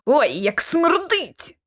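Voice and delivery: drawn-out recoil in disgust; disgusted cry. A narrator's reading. Lockwood reacts to the returning smell, disgusted cry. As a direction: groan at a foul stench, slowly. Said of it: "Він аж скрикнув з огиди".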